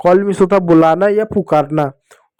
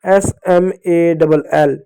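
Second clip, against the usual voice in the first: slow spelling; much slower than usual. Shown as a demonstration. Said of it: "अब एक और नया चीज़ समझाता हूँ".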